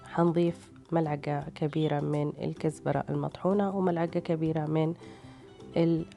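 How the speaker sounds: normal talking voice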